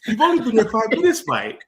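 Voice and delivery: John Obi Mikel. Retold or imitated. Podcast host quoting a footballer's catchphrase to tease, mock-indignant impression. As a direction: quote it high and loud, like a famous line. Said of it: "in the words of our great prophet and father, John Obi Mikel".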